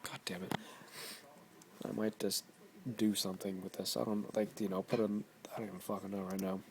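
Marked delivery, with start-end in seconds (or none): none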